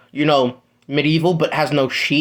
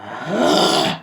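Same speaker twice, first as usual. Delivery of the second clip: gasp; 2 semitones lower than usual. Acted. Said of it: "and he goes".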